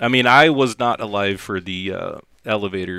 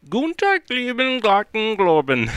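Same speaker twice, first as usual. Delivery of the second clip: high, squeaky fake German count-in; 13 semitones higher than usual. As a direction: sing out the fake German greeting. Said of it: "he goes".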